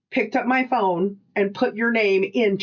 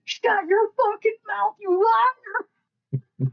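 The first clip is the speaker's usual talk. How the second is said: retold scream; 13 semitones higher than usual. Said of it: "heard me screaming".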